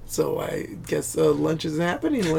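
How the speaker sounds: quietly imitated awkward aside; understated movie-line delivery. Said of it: "That was a very nice delivery".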